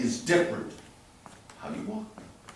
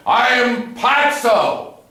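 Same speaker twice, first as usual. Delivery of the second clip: higher, louder, slow and grand, chest out; grand self-presentation; 7 semitones higher, 4 dB louder, much slower than usual. Shown as a demonstration. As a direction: announce yourself like a proud showman. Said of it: "present your body the way you say".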